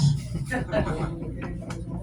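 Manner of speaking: silent wheezing laugh after the joke. Real, not acted. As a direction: let a breathy laugh slip out. Laughing at own punchline.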